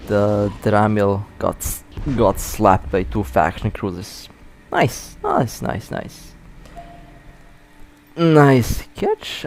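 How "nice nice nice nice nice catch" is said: quick delighted repeats; happy under-breath cheer; excited. Real, not acted. Celebrating the catch.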